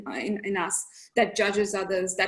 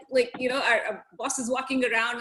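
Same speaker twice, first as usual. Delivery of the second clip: usual speaking voice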